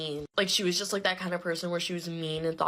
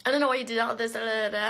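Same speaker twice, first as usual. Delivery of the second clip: higher-pitched mock complaint; mocking imitation; 5 semitones higher, faster than usual. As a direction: mimic her rant dismissively in a raised voice. Mocking retold rant of ex-friend.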